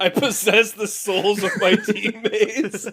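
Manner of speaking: drawn-out words through laughter; laughing. Real, not acted. Joking along, cracking up.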